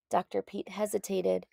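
ordinary delivery, nothing marked

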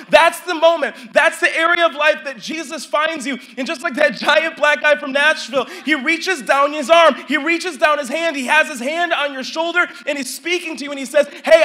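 loud, high, slowed preaching with shouted peaks; full-force preaching. Acted. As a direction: preach it to the back row.

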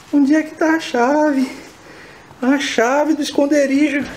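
high, loud desperate voice; frantic. Acted. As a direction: beg to find the key. Frantic key search.

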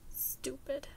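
breathy whisper to oneself. A narrator's reading. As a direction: hiss it quietly at yourself. Repeats self-insult, whispering.

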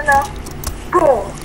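usual speaking voice

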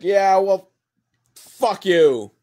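hammed-up offended outburst; mock angry retort. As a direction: snap it back before storming off. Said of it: "but he storms off".

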